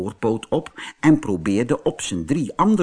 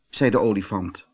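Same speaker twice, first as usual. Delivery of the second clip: ordinary delivery, nothing marked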